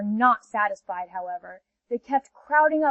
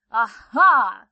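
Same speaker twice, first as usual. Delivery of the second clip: panther's loud exclamation; 7 dB louder than usual. Acted. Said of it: "said the panther".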